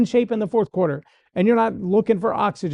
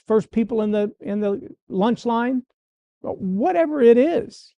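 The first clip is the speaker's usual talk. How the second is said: ordinary talk, nothing marked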